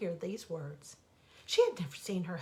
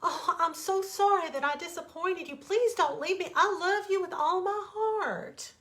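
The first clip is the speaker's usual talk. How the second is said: higher, louder pleading, slowing at the end; pleading cry; 9 semitones higher, 5 dB louder than usual. Acted. Wife begging husband not to leave, higher pleading. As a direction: beg him to stay. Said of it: "she cried".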